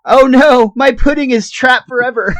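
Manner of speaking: loud, high wail of dismay; hammed-up tragic cry; Homer's mock despair. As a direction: lament it like an overgrown child. Acting Homer's despair over his pudding.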